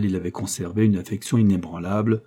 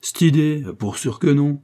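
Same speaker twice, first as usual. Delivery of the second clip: lively, higher-pitched protest; exclaiming; 6 semitones higher, 3 dB louder than usual. A narrator's reading. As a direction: cry it out as if the very idea is absurd. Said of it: "s'écria Léon". (s'écrier vivement)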